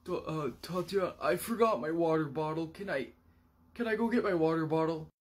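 timid coworker voice. Retold or imitated. Philip timidly asks to leave.

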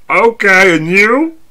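perky server greeting; waitress voice. Acted. Waitress again, stammering.

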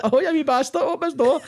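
high-pitched wee voice, pleading to be let out; imitated high wee yell. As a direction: mimic a trapped man's wee high voice. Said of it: "I can still hear his wee voice like".

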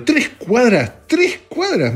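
drawn out high and slow, stretching TRES in disbelief; exasperated disbelief. Real, not acted. Parked three blocks from the office, complaining to listeners in high, drawn-out disbelief. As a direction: make three blocks sound like a marathon.

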